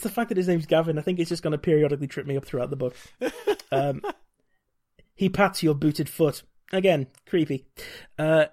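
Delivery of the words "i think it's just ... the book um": ordinary talk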